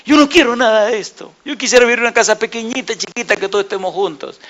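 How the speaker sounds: high-pitched mock tearful plea; tearful imitation. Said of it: "con lágrimas me decía".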